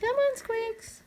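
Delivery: very high coaxing call; high pet call. Real, not acted. Owner coaxes the pet to come, very high. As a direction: coax him in a sing-song high.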